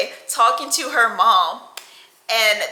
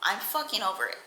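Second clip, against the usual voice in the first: much softer, fed-up mutter; muttered quote; 11 dB quieter, faster than usual. Retold or imitated. Stumbling back from girlfriend, soft fed-up mutter. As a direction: mutter it under your breath. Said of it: "and I'm like".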